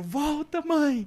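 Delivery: put-on high child's wail; a little boy begging mom to go back; high-pitched kid crying out.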